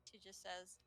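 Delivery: ordinary delivery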